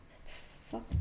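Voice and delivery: whispered, breathy aside: "forte"; soft whispered affirmation. Real, not acted. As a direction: breathe it to yourself. Repeats card message softly.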